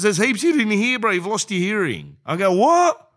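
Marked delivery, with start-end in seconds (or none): 0.0-2.2 impatient repeat. Retold or imitated. Audiologist repeating louder. 2.5-3.1 a sudden high, loud "What?"; incredulous what. Retold or imitated. Comedian to the audiologist, a high, loud incredulous what. Pretending not to hear her.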